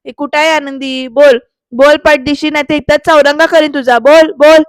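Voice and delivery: loud, pushed-up acted demand; angry threat. Shirke threatens the peon over Anandi, loud demand.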